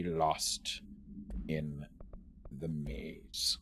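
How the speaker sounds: low, soft, near-whispered ending; spooky storyteller trailing into a whisper; ominous hush. Acted. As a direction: drop low and hushed for dramatic effect. Ominous end of document reading.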